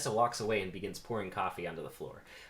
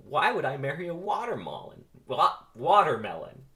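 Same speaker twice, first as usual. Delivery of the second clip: high, loud baffled question; incredulous; 7 semitones higher, 8 dB louder than usual. Acted. [name] jokes about marrying a watermelon, incredulous. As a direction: repeat it in loud disbelief. Acted incredulous joke response.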